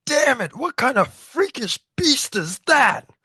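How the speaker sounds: slow, high startled shout; high loud alarmed giant. Acted. Recapping manga to friends, startled giant shouting high. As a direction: bellow it high in shock.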